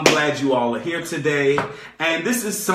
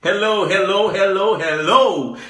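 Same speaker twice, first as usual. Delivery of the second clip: radio host greeting; 2 semitones higher, 3 dB louder than usual. Acted. Radio host opening the show.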